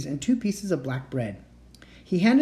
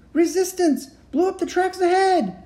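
alarmed shout; 5 dB louder than usual. Acted. Panicked passengers shout news.

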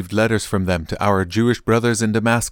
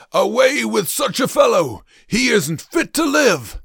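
high-pitched, drawn-out yell of outrage; angry crowd shout; 13 semitones higher than usual. A narrator's reading. The crowd yelling against Paul, angry shouting. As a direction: shout it out like a mob demanding death. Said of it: "they all began to shout".

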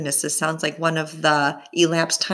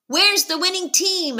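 higher-pitched call over the crowd; loud question; 12 semitones higher than usual. Acted. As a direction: shout the question over the noise. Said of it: "another reporter asked loudly".

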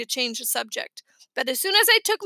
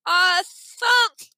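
louder, higher, as with a heavy cold; stuffed-nose voice; 5 semitones higher, 5 dB louder than usual. A narrator's reading. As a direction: speak through a pinched nose. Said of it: "Dad said, holding his".

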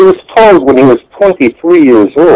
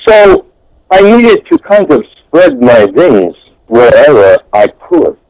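ordinary delivery

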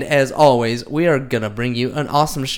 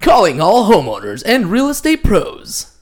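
lightly voiced movie trailer read; a hint of a dramatic trailer voice; 6 semitones higher, 4 dB louder than usual. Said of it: "In the movie voice".

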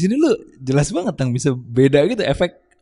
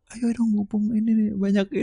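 eager urging; 10 semitones higher, 4 dB quieter than usual. Acted. Mimics eager opportunist grabbing the chance.

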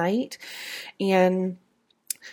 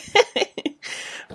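sleepy giggle. Real, not acted. Laughs at her own sleepiness.

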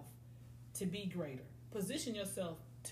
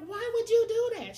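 high, loud shriek of disbelief; shocked shout; 14 semitones higher, 13 dB louder than usual. Retold or imitated. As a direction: cry it out, way up and loud. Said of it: "And I'm like".

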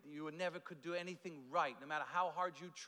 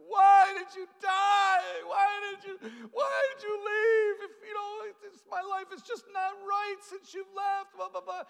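mock crying; 14 semitones higher, 15 dB louder than usual. Man crying over a gravestone, mock wailing. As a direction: bawl it out in hammed-up grief. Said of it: "and he's crying".

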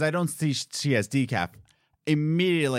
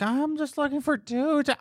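high put-on lady voice; mock customer voice. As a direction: play the clueless customer.

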